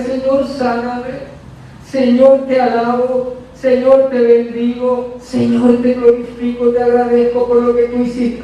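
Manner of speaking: woman worshipping God in her pain, fervently; fervent prayer. Retold or imitated. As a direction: pray it, pleading then praising.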